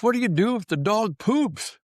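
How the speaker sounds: high mock neighbor voice; mimicking nosy neighbor. Neighbor stopping the dog walker with a question, high mock voice. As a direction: pitch it up like a curious busybody.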